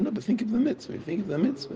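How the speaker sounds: high-pitched, urging retold reply; retold appeal, pitched well up; insistent correction. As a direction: urge them earnestly, voice lifted high. His retold correction of the family.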